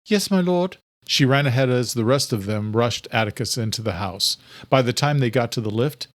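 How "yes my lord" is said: higher obedient servant; quick dutiful reply, higher pitch; Adela's voice. A narrator's reading. Adela answers the king.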